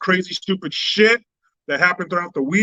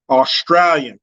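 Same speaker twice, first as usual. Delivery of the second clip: normal talking voice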